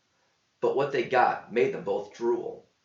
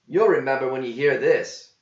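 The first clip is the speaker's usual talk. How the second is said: louder, lightly voiced; voiced companion; 3 semitones higher, 7 dB louder than usual. A narrator's reading. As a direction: tease with a promise. Gear promises song.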